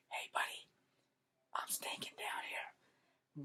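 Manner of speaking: slow whispered armpit voice; whispering armpit. Pretend. Left armpit warning its owner, slow whisper. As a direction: let the armpit whisper a secret.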